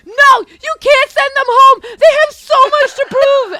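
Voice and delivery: high, loud, drawn-out plea; overinvested protest. Retold or imitated. Husband pleading for an eliminated contestant, emotionally overwrought. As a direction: protest the elimination at full pitch. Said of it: "he gets emotionally s-, he gets so invested".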